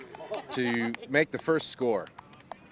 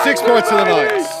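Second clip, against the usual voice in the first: loud, higher score announcement; triumphant shout; 10 semitones higher, 13 dB louder than usual. Real, not acted. Commentator announcing the score, loud and triumphant.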